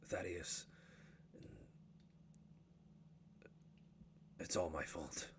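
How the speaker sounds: low, soft, near tears; dwarf father choking up, low and soft. Acted. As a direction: hold back tears, quietly. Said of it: "sort of choking up a little bit".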